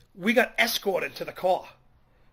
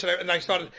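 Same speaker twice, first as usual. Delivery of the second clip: ordinary talk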